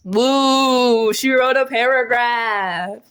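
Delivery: slow, sneering mock of the teasing friend; mocking bully. The podcast friend mocks her paragraph, drawn-out sneer. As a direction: taunt her like a smug boy. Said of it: "Sure, whatever".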